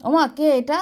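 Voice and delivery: loud calling question; to someone hard of hearing. Real, not acted. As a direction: call out clearly to grandma. Calling to elderly mother.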